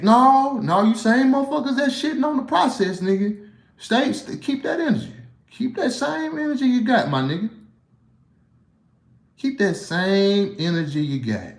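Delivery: high-pitched heated rant at the critics; heated callout. Real, not acted. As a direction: call them out, voice jumping high.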